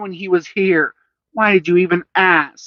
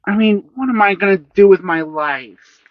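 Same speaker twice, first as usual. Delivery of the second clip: groaning; 9 semitones higher, 4 dB louder than usual. A narrator's reading. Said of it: "groaning more".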